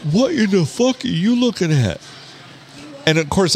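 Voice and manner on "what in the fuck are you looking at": high-pitched retold challenge; confrontational stranger. Gigantic guy confronts the man eyeing his girl, indignant. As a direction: play an aggressive guy picking a fight. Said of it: "this guy goes".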